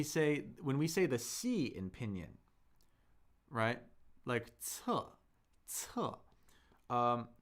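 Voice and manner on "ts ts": "ts" let out with a little air; isolated, airy "ts"; aspirated ts. Shown as a demonstration. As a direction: build up and release a puff on "ts". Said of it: "it's aspirated".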